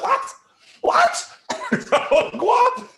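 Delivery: high, laughing disbelief; laughing bewildered what, what; incredulous what. Retold or imitated. Sahaba baffled by the Prophet, laughing disbelief.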